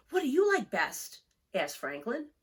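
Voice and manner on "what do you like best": higher, eager little turtle voice; a young turtle asking his teacher; Franklin's child voice. Acted. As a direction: ask it up high like a curious child.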